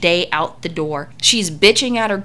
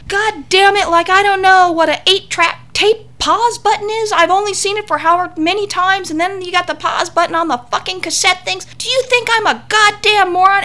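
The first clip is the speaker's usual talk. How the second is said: much higher, louder mock rant; furious screaming; 12 semitones higher, 4 dB louder than usual. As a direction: shriek it like a woman losing it on the phone. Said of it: "She starts screaming at this girl".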